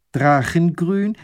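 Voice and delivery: "Drachengrün?" high, loud and slow, rising at the end; astonished question. Acted. Lady querying the painter's colour, astonished "Drachengrün?". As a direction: echo "Drachengrün?" slowly in disbelief, lifting the pitch.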